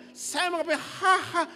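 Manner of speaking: very high, loud, halting gasps before blurting the name; struggling to say the name. Retold or imitated. Preacher imitating the man finally forcing out Hans's name. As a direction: force the name out with strained gasps. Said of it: "dia susah sekali sebut nama Hans".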